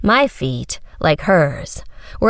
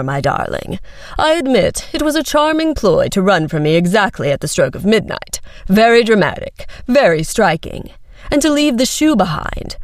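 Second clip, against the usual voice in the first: condescending prince; 2 semitones higher than usual. A narrator's reading.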